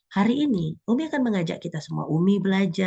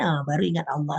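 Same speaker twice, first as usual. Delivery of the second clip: ordinary delivery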